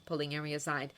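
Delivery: ordinary delivery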